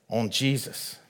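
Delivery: normal talking voice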